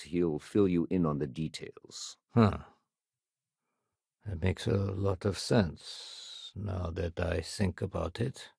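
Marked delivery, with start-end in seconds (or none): 4.3-8.5 slow, soft realization; pondering. Acted. Officer reconsidering what they were told, pondering. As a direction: think it through quietly, half to yourself. Thinking over what was said.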